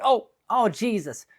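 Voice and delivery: very high, loud Jersey outburst; Jersey accent. Acted. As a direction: cry out as an appalled Jersey woman. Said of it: "like, the accent or whatever".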